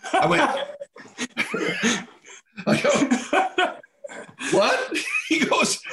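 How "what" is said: much higher, stunned retold "What?"; a jolt of disbelief, pitched way up; shocked what. Hearing Brett is in jail.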